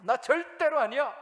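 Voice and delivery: loud, high, desperate denial; Peter's sworn denial. Acted. Preacher voicing Peter swearing to the servant girl, loud desperate denial. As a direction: shout it out, swearing you're not one of them.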